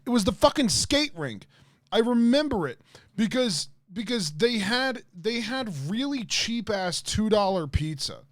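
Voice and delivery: high, loud, emphatic recollection; insistent outburst. Real, not acted. Streamer insisting he remembers the skate rink, high and emphatic. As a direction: blurt it out high and adamant.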